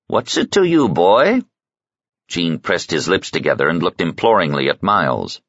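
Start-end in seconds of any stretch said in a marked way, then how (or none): 0.1-1.4 put-on high, pushy old woman's challenge; gruff old woman. A narrator's reading. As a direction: snap it at the boy like a tough old dame. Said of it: "Suze's voiced reply".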